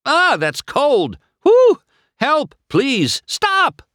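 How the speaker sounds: high, loud shocked pleading; frantic, high-pitched cries; sputtering yells. A narrator's reading. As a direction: yell for help under freezing water. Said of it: "he sputtered".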